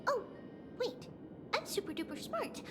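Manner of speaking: hushed quick mutter to self; quiet aside. Acted. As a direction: drop to a fast hush.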